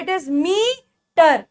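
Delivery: meter as a very slow "meee... ter"; long E, broken up. Shown as a demonstration. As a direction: hold "mee", pause, then add "ter" in meter. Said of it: "ये कैसे आता है".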